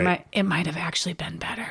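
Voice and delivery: hushed secret aside; whispering. Real, not acted. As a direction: whisper it like a confession. Secret aside, "don't tell anybody.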